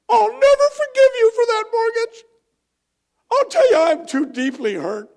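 high, loud hammed-up hurt voice; mock wounded bank. Preacher mocks the bank as a hurt person, hammed-up high wail. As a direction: squeal it like an offended diva.

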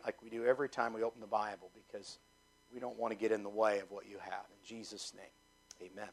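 hushed, low pastoral prayer. Real, not acted. Preacher praying to God for missionaries and tonight's study, quiet prayer. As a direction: pray softly, letting phrase ends fall away.

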